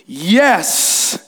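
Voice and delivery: long, resonant swelling answer; great voice. Acted. Aslan behind their backs, great voice. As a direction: draw the word out grandly. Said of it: "said a great voice".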